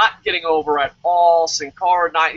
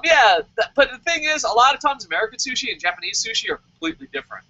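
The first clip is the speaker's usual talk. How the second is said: ordinary talk, nothing marked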